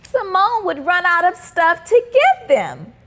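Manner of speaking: ordinary delivery, nothing marked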